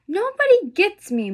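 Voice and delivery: high, loud cry of frustration; upset outburst. Acted. Zane runs home to Mama, high loud upset cry. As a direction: blurt it out, hurt and fed up.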